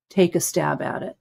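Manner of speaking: normal talking voice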